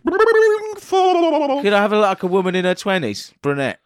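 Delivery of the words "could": high, very slow wobble; wobbly voice. Pretend. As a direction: copy the wobbly voice. Mimicking the wobbly voice back.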